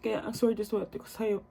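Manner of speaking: ordinary talk, nothing marked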